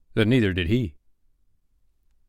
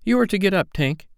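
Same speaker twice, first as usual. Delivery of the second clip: put-on high-pitched boy calling to her; lightly acted boyish call; 10 semitones higher than usual. A narrator's reading. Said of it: "Nibs called".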